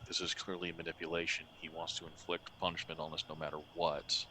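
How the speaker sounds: low, hushed voiced murmur; soft, secretive stage undertone; whispering. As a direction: confide it quietly to an ally. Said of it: "he's gonna whisper as quietly as he can".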